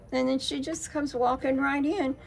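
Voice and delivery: ordinary talk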